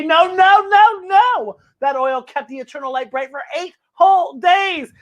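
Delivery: high dog, slowing on the end; insist, stretching eight whole days; emphatic dog voice. Acted. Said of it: "says Applesauce".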